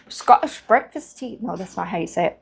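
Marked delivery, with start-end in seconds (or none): 0.1-1.4 higher, slow, botched Scottish accent; attempted Scottish accent. Shown as a demonstration. Vlogger naming her tea, clumsy attempt at a Scottish accent. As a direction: have an unsure go at a Scottish accent. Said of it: "I can't do a Scottish accent".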